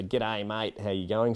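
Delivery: mock ocker Australian accent; hammed-up Aussie accent. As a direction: lightly ham up an Australian greeting. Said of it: "tried to make sure that our accents were".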